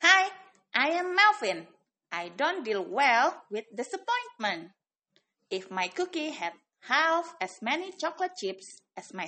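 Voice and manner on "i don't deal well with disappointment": ordinary delivery, nothing marked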